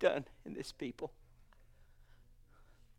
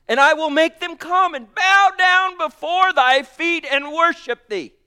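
loud, raised preaching voice; scripture proclaimed; 9 semitones higher, 10 dB louder than usual. Acted. Preacher quoting God's promise to the church, loudly. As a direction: thunder the promise out. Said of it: "You're going to share this with me".

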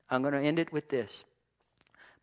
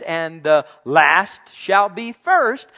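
drawn out and louder, each word weighed; slow emphatic quote; 10 dB louder, much slower than usual. Acted. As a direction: proclaim it slowly to the congregation. Preacher quoting scripture emphatically.

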